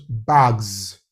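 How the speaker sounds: "bags" drawn out with a final z; voiced plural ending. Shown as a demonstration. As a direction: stretch "bags" slowly into a buzzing z. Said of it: "when you add S to it".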